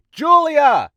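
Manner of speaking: high yell to another room; calling out loudly. Acted. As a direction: holler for her across the house.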